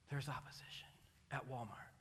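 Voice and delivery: sudden hushed whisper. Acted. Preacher whispers a warning to the congregation. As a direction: drop to a secret whisper for effect. Sermon aside, dropped to whisper.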